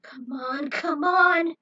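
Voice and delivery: building, rising to a loud plea; desperate urging. Acted. Healer urging fallen bouncers to eat, desperate rising plea. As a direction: beg them to wake up.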